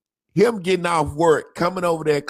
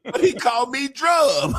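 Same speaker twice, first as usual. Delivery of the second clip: high, laughing disbelief; pitched way up with a laugh in it; amused; 10 semitones higher than usual. Real, not acted. Telling about friend's name mix-up, amused high voice.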